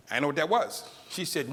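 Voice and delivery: normal talking voice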